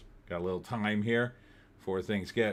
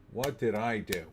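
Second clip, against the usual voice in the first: normal talking voice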